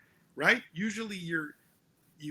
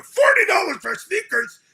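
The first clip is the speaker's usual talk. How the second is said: much higher, loud mock shout; mother outraged; 12 semitones higher, 7 dB louder than usual. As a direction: ham up a mom balking at the price. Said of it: "And I go".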